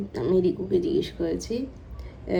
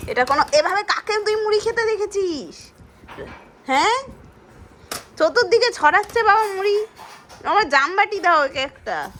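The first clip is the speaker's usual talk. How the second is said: exasperated scolding; 11 semitones higher than usual. Real, not acted. Mother scolding toddler, scattering puffed rice.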